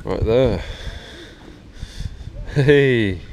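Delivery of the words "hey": ordinary talk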